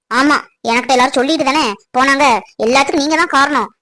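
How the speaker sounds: ordinary delivery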